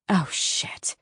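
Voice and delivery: under-the-breath curse; whispered thought. A narrator's reading. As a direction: mutter it to yourself. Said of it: "she thought".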